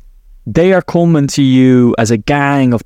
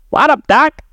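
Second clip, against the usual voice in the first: a much higher, cartoon rabbit voice; Bugs Bunny; 11 semitones higher than usual. Coach playfully imitating a cartoon rabbit to defuse fear. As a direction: pop up high and cheeky like a cartoon rabbit. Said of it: "I was about to do the, the voice there".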